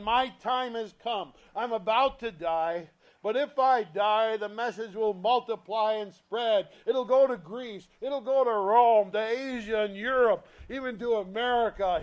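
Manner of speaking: loud, rising preacher's proclamation; preacher voicing Jesus, fervent and loud; preaching Jesus' words. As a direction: build the promise outward with fervour. Voices Jesus in sermon.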